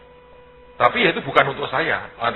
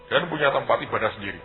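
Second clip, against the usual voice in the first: ordinary delivery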